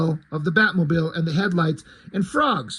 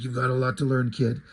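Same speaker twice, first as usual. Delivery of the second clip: a lower, gruff delivery; low Batman voice; 7 semitones lower than usual. Retold or imitated. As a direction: say it low like a tough mentor.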